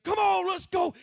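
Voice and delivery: high, loud motivational shout; rallying yell. Retold or imitated. Son rallying his men up the mountain, yelling. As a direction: yell it to get the men charging. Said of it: "I yelled at my men".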